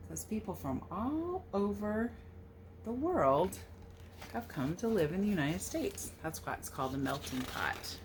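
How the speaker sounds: plain reading voice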